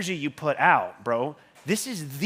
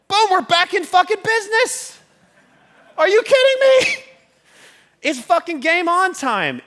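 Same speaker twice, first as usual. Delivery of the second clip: much higher, louder cheer of victory; triumphant shout; 8 dB louder than usual. Retold or imitated. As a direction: yell it like you just won. Retelling the deal coming through.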